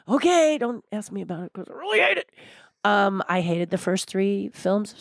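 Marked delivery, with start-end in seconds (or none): 0.1-2.3 high okay tumbling into a rapid growl of loathing; passionate hatred. Acted. Woman warning a fan she really hates the movie, exaggerated loathing. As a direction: start high, then rush through it vehemently. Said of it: "it's very passionate".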